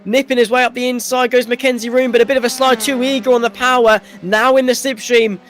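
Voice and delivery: excited motor racing commentator, pitch held up; live play-by-play. Real, not acted. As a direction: call the on-track action as it happens.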